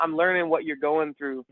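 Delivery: ordinary delivery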